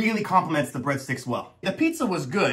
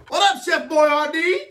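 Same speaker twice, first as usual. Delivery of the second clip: hyped greeting; 9 semitones higher, 3 dB louder than usual. Pretend. Greeting the pizza.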